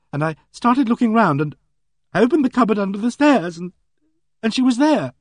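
high, halting and upset throughout; the girl tells how she found the body, high and shaken; shaken retelling. Acted. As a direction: keep the shock under every word.

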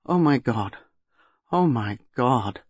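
slow, sinking horror; stunned dismay. A narrator's reading. Basil, face buried in his hands, stunned dismay. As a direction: let it sink in, dragging the last one.

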